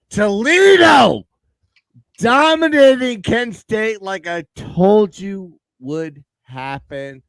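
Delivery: slow, loud, triumphant brag; gloating. Real, not acted. Host bragging to viewers about his winning pick, triumphant. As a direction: stretch it out and gloat.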